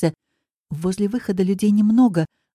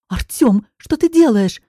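higher, louder burst of astonishment; startled exclamation; 5 semitones higher, 6 dB louder than usual. A narrator's reading. Woman to the coach who startles her, astonished exclamation. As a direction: exclaim in sharp surprise. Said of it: "восклицаю я".

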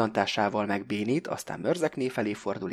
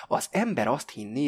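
plain reading voice

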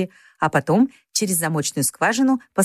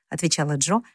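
ordinary delivery

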